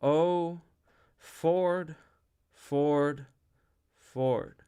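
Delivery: slow, loud invocation with long pauses; each word drawn out loudly, then stepping down; very slowly. A narrator's reading. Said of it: "very slowly".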